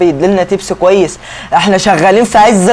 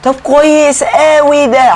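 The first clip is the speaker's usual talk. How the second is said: higher, slow, drawn-out delight; pleased excitement; 7 semitones higher, slower than usual. Acted. Gamer reacting to a nearby order, delighted and drawn out. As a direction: savour the good news in a put-on voice.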